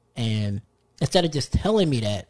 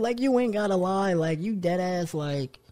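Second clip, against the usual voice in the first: mock exasperated; 6 semitones higher than usual. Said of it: "I was like".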